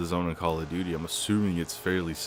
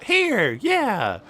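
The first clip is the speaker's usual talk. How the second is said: mock slow kid; 7 dB louder, slower than usual. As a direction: answer proudly like a clueless kid. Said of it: "that one slow kid in school".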